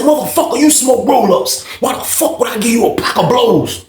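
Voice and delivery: high, indignant mimicked outburst; arguing.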